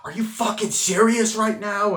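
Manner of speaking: high, loud disbelieving shout; exasperated yell. Retold or imitated. Husband snapping at his wife in an argument, exasperated yell. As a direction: snap back at his wife mid-argument. The couple arguing.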